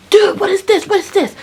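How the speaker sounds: high, loud outburst; outraged demand. Retold or imitated. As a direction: yell it in frantic disbelief. Acted outburst at the discovery.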